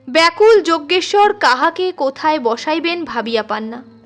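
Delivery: ordinary delivery, nothing marked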